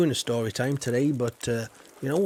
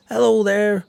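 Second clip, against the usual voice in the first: calling out hello, much louder and higher; loud greeting; 8 semitones higher, 10 dB louder than usual. Acted. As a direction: shout a big cheery hello.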